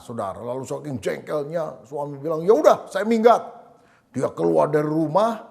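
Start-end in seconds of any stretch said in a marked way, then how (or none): 2.4-3.4 higher and louder, a huffy shout; angry outburst. Retold or imitated. Husband storming out after a fight with his wife, angry shout. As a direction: snap it out like a fed-up husband walking out.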